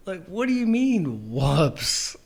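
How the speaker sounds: loud, high "What do you mean, whoops?"; incredulous outburst. Retold or imitated. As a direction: blurt it out in baffled disbelief.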